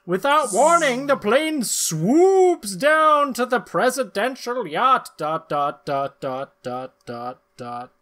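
hammed-up narrator; story narration. A narrator's reading. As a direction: read it like a serial announcer. Narrates comic panels.

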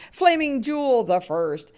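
ordinary delivery

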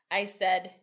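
plain reading voice

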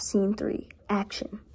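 hushed aside to the camera; whisper. Real, not acted. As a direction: whisper the scene number. Whispered scene announcement.

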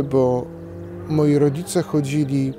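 normal talking voice

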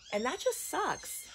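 voice breaking. Real, not acted. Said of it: "I'm just frustrated".

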